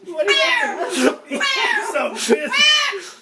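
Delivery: high, loud, drawn-out cries; startled shout. Real, not acted. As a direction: yell over the racket, high and loud.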